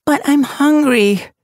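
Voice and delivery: put-on high, slow whiny young cat voice; complaining. A narrator's reading. Leopard Paw whines to her friend, high and slow. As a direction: drag it out like a sulky kit. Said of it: "Leopard Paw complained".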